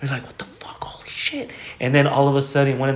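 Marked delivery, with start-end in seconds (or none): 0.2-1.5 acted-out hushed whisper; stunned whisper. Family secretly watching aliens walk around, stunned retold whisper. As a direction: whisper it, as if hiding and amazed.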